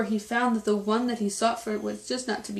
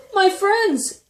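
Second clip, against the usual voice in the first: very high, loud, overjoyed call; loud storybook exclamation, pitched way up; crying out; 12 semitones higher, 8 dB louder than usual. A narrator's reading. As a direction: cry out to long-lost friends. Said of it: "cried the elephant".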